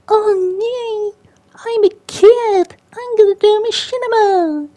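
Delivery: put-on very high, loud squeaker kid voice; squeaky kid. Gamer mocking kid voice actors, squeaky kid voice. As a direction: put on a mock little-kid squeal, drawn out. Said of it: "being, like, squeaky, like".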